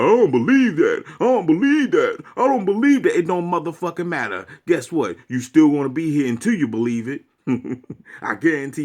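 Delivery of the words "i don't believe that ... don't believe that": mocking denial. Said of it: "You could be like".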